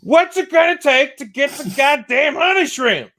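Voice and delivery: high-pitched frantic yell; screamed demand. Acted. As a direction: scream it like a desperate, fed-up customer. Acted demand for honey shrimp.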